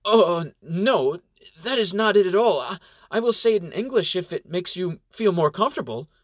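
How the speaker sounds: high, flustered denial; flustered shock. Acted. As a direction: caught off guard, rushing to deny. Shocked at the question.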